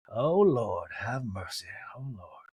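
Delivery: low, sinking mock lament; imitated weary dismay. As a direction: sigh it out low, fading away. Mary reacting to a fight.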